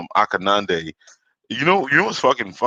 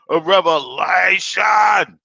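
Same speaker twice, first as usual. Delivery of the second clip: high, slower shout of revelation; shouted proclamation; 8 semitones higher, 4 dB louder, much slower than usual. Acted. Repeating the opener to viewers, full shout. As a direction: belt it out even bigger.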